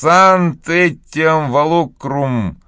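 drawn out, word by word, slowly. A narrator's reading. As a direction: stretch each Latin word very slowly. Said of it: "очень медленно произнёс Том".

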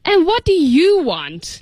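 mildly irritated storybook voice, leaning on YOU; annoyed. A narrator's reading. Pharmacist to a child interrupting him, annoyed. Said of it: "asked in an annoyed tone of voice".

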